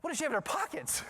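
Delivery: high-pitched baffled exclamation; mock alarmed disbelief. Watching parent alarmed by the pocket search, high mock disbelief. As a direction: wonder aloud in comic alarm. Teller's alarmed thought in the scene.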